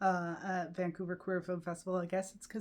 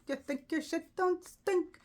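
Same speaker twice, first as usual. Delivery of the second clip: mock snooty jab; 9 semitones higher than usual. Mocking West Coast gays.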